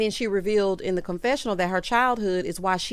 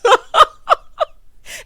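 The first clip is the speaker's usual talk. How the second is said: high laugh; 12 dB louder than usual. Real, not acted. Laughing at fake crying.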